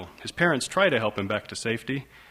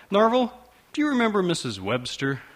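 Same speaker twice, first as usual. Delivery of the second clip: chipper mother; 8 semitones higher than usual. Acted. Mother voiced chipper on phone.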